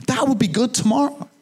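lightly mimicked insistence; insistent wife. Wife repeats her nudge, insisting.

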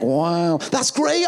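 high-pitched show of being impressed; feigned enthusiasm. Retold or imitated. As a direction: sound delighted while privately doubting it. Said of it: "And inside I went".

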